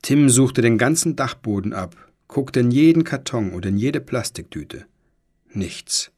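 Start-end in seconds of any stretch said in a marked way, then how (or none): 5.5-6.1 whispered, hushed; whispering. A narrator's reading. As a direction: breathe the word out. Tense search, nothing found.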